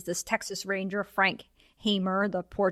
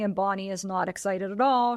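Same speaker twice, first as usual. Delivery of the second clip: usual speaking voice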